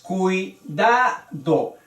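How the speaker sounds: slow "Cuidado" split cui-da-do; three unhurried beats in "Cuidado", diphthong held together; syllable by syllable. Shown as a demonstration. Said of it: "Tres sílabas".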